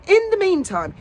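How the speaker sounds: ordinary talk, nothing marked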